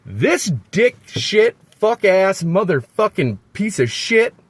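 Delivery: high, furious string of swearing; upset outburst. Real, not acted. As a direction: spit out each insult slowly at first, building in anger. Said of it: "I'm just really upset".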